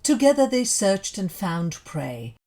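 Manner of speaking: ordinary delivery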